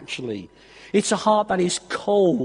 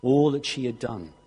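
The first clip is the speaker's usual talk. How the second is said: hushed earnest preaching; 7 semitones lower, 6 dB quieter than usual. Acted. Preacher gently urging his church to forgive, hushed. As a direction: draw the congregation in gently, almost confiding. Quiet earnest appeal to congregation.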